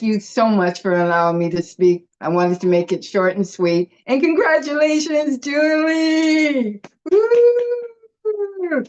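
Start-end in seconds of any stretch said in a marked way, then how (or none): 4.1-8.8 high, bright whoop of congratulations; excited cheer. Real, not acted. Friend cheering Julie's webinar anniversary, excited whoop. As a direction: cheer her on at the top of your voice.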